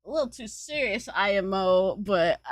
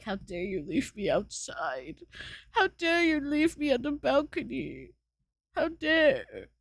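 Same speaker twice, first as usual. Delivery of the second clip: high, wounded cat's complaint; indignant cat; 5 semitones higher than usual. Acted. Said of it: "look up at me and meow".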